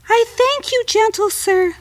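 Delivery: very high, frightened little fly; high tiny fly voice. Acted. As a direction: squeak it like a scared tiny insect.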